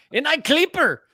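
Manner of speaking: put-on the code name said high and loud in a joke Belgian accent; playful Belgian accent try; mock Belgian accent. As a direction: pitch it up like a fake Belgian. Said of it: "that's my Belgian, uh, accent".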